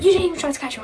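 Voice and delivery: high-pitched retold reproach; mock accusation. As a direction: imitate an indignant complainer, pitched high.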